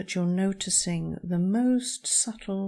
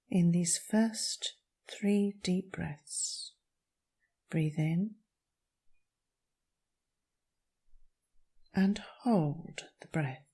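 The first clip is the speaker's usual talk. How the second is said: calm meditation voice with long pauses; guided meditation. Acted. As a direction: guide them gently through each breath, leaving space. Leads a breathing meditation.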